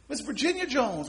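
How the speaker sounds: ordinary delivery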